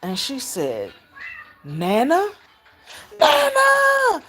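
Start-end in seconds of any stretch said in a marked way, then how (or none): 1.6-2.3 put-on tentative rising question, as a child; little girl unsure it's really you. Retells child's first sighting of her. 3.2-4.2 high, loud squeal of recognition; little girl calling out in joy; excited child's shout. Retold or imitated.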